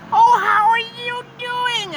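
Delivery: high, drawn-out gushing greeting; patronizing stranger. Acted. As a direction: coo it very high, loud and slow, as if to a small child.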